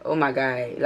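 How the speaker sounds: soft, low oh my God breaking into a laugh; real laughter. Real, not acted. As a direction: give in to quiet, disbelieving laughter. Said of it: "I'm laughing 'cause it's".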